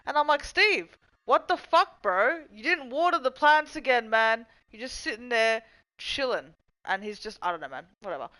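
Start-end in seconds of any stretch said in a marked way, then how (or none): 0.4-6.5 higher, louder mock telling-off of a lazy gnome; hammed-up exasperated rant at Steve; mock scolding. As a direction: scold the gnome like a fed-up flatmate.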